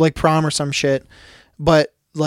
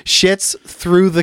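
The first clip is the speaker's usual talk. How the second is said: usual speaking voice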